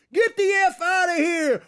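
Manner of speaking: picture yourself yelling at a crowd to leave; imagined angry shout. Pretend. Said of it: "in a restaurant and shouting".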